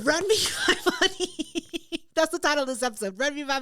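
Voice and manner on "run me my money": laughing through the phrase, higher and slow. Real, not acted. Host laughing while repeating the episode title. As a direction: break into laughter mid line.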